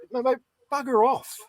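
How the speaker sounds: lightly hammed-up brush-off; mock official brush-off.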